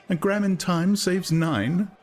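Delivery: high, slow sing-song recital of a slogan; a woman chirps a hypnopaedic proverb; Lenina reciting a maxim. A narrator's reading. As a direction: recite it like a nursery rhyme learned in sleep. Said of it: "producing a bright treasure of sleep-taught wisdom".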